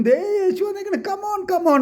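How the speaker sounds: high, eager call to come back; high excited shout to the disciples. Retold or imitated. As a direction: call the friends back, thrilled. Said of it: "എന്ന് പറയും".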